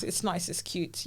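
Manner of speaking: whispered aside; soft whispered afterthought. Real, not acted. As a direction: breathe it out softly.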